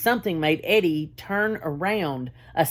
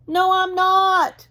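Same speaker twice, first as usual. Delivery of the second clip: much higher, louder, slow cry; 13 semitones higher, 5 dB louder, slower than usual. A narrator's reading. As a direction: refuse the bear loudly. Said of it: "cried the voice".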